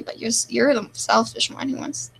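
ordinary talk

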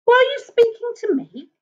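high-pitched polite monster voice; un-monstrous monster. A narrator's reading. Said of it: "voice that was not at all monstrous".

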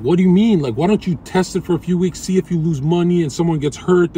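high, loud mock protest; imitating incredulous objectors. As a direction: push back loudly, as mimicked critics. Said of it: "They're like".

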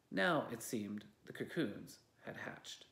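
plain reading voice

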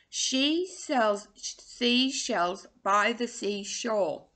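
very slow, every word spaced; slow tongue twister. Shown as a demonstration. Coach modelling the twister slowly for students. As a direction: spell it out slowly. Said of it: "really funny when you start to say them really fast".

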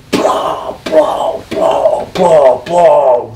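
repeated explosive vocal blows; imitated brawl noise. Partner tearing the room apart, imitated crashes. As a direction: burst out each smashing sound. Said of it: "It's not just a bunch of noise".

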